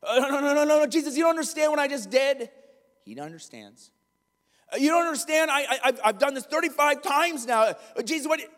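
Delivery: a guilty person insisting they don't deserve it; anxious sinner. Retold or imitated. Sinner arguing with Jesus about his failures, flustered and high.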